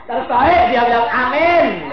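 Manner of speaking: high laughing through the words; laughing preacher. Real, not acted. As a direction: laugh it out then answer amen. Laughing at congregation.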